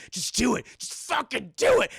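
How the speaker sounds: rising to a loud high demand; goading. Retold or imitated. Suicidal Mel goading his captor.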